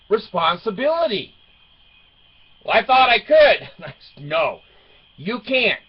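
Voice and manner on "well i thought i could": high, louder, innocent excuse; sheepish teen daughter. Retold or imitated. Daughter voiced in the story.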